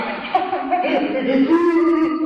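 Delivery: high burst of imitated laughter; mock laugh. Showing the audience how fast moods flip, high mock laugh. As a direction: fake a quick high laugh to show a mood swing. Mimics laughing then crying.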